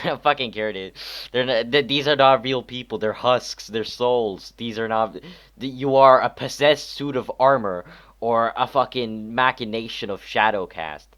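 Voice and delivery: loud, high exasperated outburst; frustrated rant. Real, not acted. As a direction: vent it at full volume. Ranting over guard voices.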